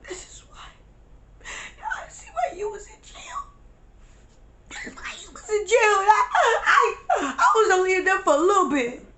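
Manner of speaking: high-pitched mock blubbering; acted crying. Arguing with friend about jail, acted sobbing. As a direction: blubber it, breaking into gasps. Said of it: "I broke down and I cried".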